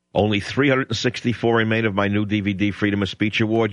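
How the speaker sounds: ordinary talk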